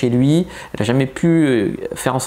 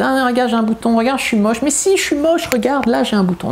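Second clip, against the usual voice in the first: much higher, whiny mocking ditzy girl; hammed-up high girly whine; airhead voice; 10 semitones higher than usual. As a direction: squeal it like a girl begging for reassurance. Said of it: "je fais des voix de conne".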